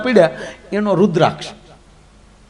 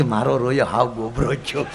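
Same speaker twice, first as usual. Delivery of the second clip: grumbling wife Parvati; 7 semitones lower, 5 dB quieter than usual. Retold or imitated. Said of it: "Parvati કે".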